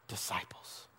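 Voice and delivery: breathy whisper of the final word; whispered close to the congregation. Real, not acted. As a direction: let the last word fall to a whisper. Closing question to Grace City.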